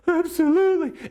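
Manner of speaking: imitating wife in a very high voice; emotional high reply. Wife answers husband in session, high emotional imitation.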